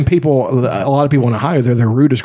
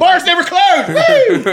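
mock party cheer; slower than usual. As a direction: whoop it up like a partier. Joking about partying in New York.

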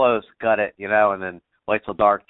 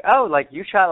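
higher, startled disbelief; retold surprise, pitched up; surprised; 9 semitones higher, 4 dB louder than usual. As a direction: catch yourself off guard. Retold surprise at friend's kill.

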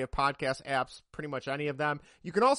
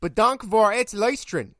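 loud, careful Dutch; "Bedankt voor het luisteren" said in Dutch, loud and deliberate; 5 semitones higher, 10 dB louder than usual. Shown as a demonstration. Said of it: "try my best in Dutch".

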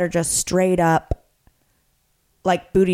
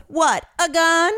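slow, higher mock-puzzled question; confused old man; 7 semitones higher, slower than usual. Imitating Larry misreading the joke, slow puzzled mock voice. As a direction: play dumb, dragging it out. Larry's deadpan reply retold.

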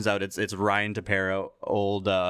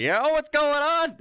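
put-on high, slow drunken hello; drunk pitcher; 4 dB louder, slower than usual. Pitcher answering a late-night prank call, drunk and loud. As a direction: ham up a wasted Texas guy answering. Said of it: "fucking wasted".

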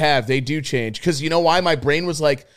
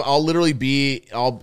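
ordinary talk, nothing marked

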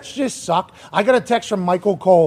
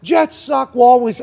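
higher, slow, whiny complaining rant; 6 semitones higher, 4 dB louder than usual. Retold or imitated. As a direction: whine it like a fed-up fan. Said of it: "I assume super whiny voice".

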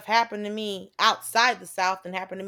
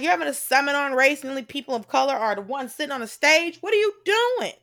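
indignant call-out; 6 semitones higher, 5 dB louder than usual. Retold or imitated. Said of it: "I was like".

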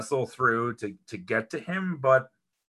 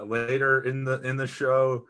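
ordinary delivery

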